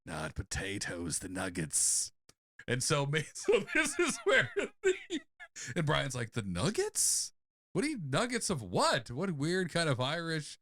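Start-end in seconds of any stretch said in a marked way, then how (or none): 0.0-2.1 low, soft, slow reveal; hushed, weighty character voice; mysterious coach. Acted. Voices O'Houlihan's mysterious correction. 6.3-10.6 mock bewildered questioning; baffled disbelief. Brian baffled by the coach.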